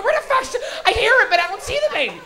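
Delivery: high, loud frantic woman; mock freaking out. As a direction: imitate a bystander panicking, high and loud. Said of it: "She was freaking out".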